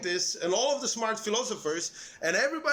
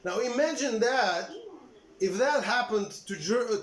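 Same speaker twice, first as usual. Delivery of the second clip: ordinary delivery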